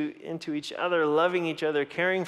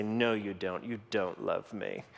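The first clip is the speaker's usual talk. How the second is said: put-on lower, softer sly boy; low teasing kid; 7 semitones lower, 7 dB quieter than usual. Son doubling down on his dad, low and sly. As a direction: tease him like a mischievous son.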